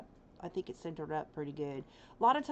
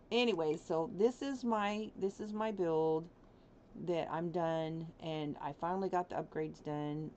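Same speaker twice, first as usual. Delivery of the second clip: ordinary talk, nothing marked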